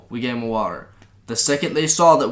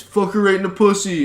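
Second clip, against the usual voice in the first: higher-pitched mock yell; mock scream; 6 semitones higher, 3 dB louder than usual. Passerby yelling into a film shoot, mock scream. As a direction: imitate a heckler yelling out. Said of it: "he just screams".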